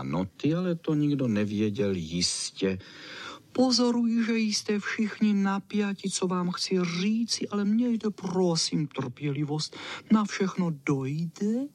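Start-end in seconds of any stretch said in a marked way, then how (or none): 3.5-11.7 high-pitched squealing dog. Acted. Čau Čau addresses the waiting dogs, squealing high. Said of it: "Zapištěl vysoko ten pes Čau Čau".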